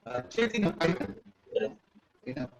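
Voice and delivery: choppy, breaking-up call line; bad connection, audio cutting out; buzzy glitchy call audio. Real, not acted. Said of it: "you're breaking up".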